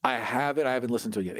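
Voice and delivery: ordinary talk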